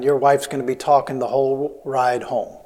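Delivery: ordinary delivery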